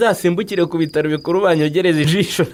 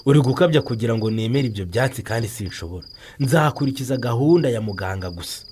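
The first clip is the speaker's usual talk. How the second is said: normal talking voice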